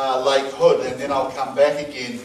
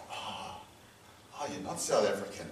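much softer, lower retold reaction; hushed, let-down acknowledgement; subdued realisation; 6 semitones lower, 15 dB quieter than usual. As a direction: imitate someone quietly taking it in. Acted realisation he's not South African.

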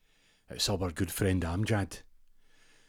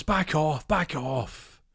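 high loud warning; 8 semitones higher, 4 dB louder, much slower than usual. Retold or imitated. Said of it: "He goes".

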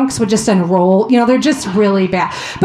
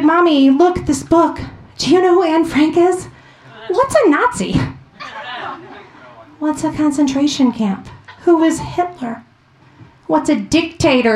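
put-on higher, eager child's rapid questions; curious little girl; 5 semitones higher than usual. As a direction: imitate a wide-eyed six-year-old. Daughter firing questions about Anne Frank.

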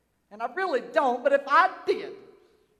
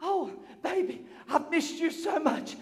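tender wife's reunion; 6 dB quieter than usual. Acted. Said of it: "As she grips her husband".

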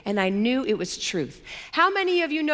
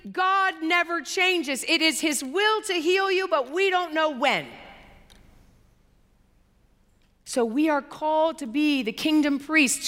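raised, fervent preaching voice; higher, insistent sermon delivery; 6 semitones higher than usual. Acted. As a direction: preach it out to the congregation.

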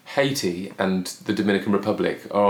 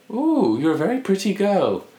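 higher, imitating a sweet old lady; light old lady impression; 9 semitones higher than usual. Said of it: "she was like".

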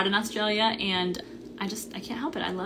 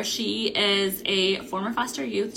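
ordinary talk

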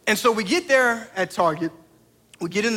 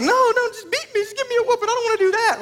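put-on much higher and louder frantic child plea; pleading kid; 5 dB louder, faster than usual. Son begging his dad not to return the toy, high frantic plea. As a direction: beg like a panicking little boy. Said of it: "And he's like".

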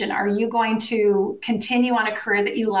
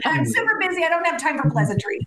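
higher and fast, brushing someone off; mock rushed; 6 semitones higher, faster than usual. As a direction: hurry through it like you're too busy. Rushing off the phone.

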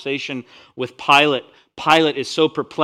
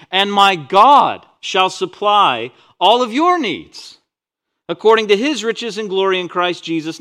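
preaching at full force; 5 semitones higher, 8 dB louder than usual. Acted. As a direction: proclaim the promise to the whole room.

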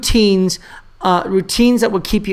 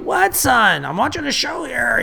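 higher-pitched mock exasperation; put-upon dad; 5 semitones higher, 4 dB quieter than usual. Dad to his son while watching Netflix, mock exasperation. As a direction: act the dad interrupted mid-show.

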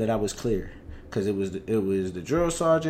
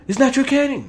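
interrogator voice; 12 semitones higher, 4 dB louder than usual. Retold or imitated. Interrogator questioning.

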